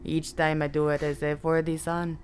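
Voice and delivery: usual speaking voice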